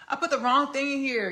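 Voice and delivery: loud, high-pitched exclamation of a mistake; dismayed outburst. Real, not acted. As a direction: cry out on catching your own blunder. Notices own cooking mistake.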